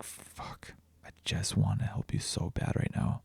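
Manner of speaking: desperate whisper, almost no voice. Retold or imitated. Dad holding his screaming toddler, whispering in desperation. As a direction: breathe it out in a strained whisper so the crying child isn't woken further.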